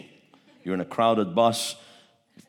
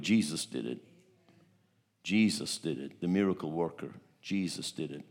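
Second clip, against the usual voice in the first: quiet earnest; 5 semitones lower, 6 dB quieter than usual. Retold or imitated. Earnest testimony to the father.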